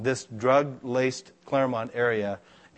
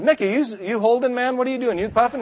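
higher, joking interrogation; mock suspicious accusation; 10 semitones higher, 4 dB louder than usual. Pastor quizzing friend in a mock suspicious voice. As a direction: tease him like a cop asking.

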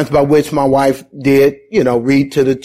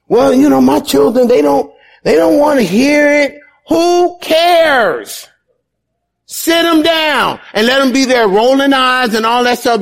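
high-pitched, insistent sermon delivery; fervent preacher driving each phrase home; impassioned preaching; 7 semitones higher than usual. Acted. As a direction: exhort the congregation, pitch held high and urgent. Exhorts parents with preaching force.

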